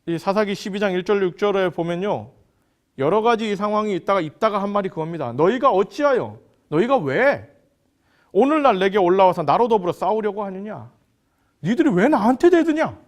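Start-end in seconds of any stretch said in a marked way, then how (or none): 5.3-13.0 acted anger. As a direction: challenge them, rising to an outburst at the end. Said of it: "이러면서 입다는 화가 났습니다". (화가 나서 따지는 흉내)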